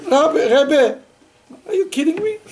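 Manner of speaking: mock disbelief. Teacher's mock protest to rabbi.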